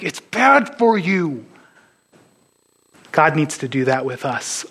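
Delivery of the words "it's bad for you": slow, drawn-out mock scolding of a pet; exasperated scolding. Owner holding down the cat eating grass, exasperated scolding. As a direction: stretch it out, pleading with a stubborn pet.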